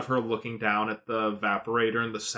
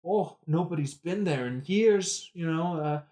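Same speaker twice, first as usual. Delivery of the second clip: higher voice, lightly put on; mock passerby; 6 semitones higher than usual. A passerby asking Rey who she is, lightly voiced. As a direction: sound nosy and curious. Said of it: "passerby is like".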